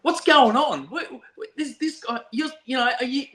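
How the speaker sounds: high, panicky questions tumbling out; frantic caller. Retold or imitated. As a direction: imitate a flustered officer on the phone. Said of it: "a frantic phone call from corrections going".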